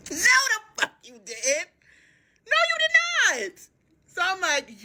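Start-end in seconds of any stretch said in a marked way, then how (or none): 0.1-3.6 high, sassy no way; squealing comic outrage; indignant denial. Real, not acted. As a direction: shoot him down, high and indignant. Indignant aside to audience.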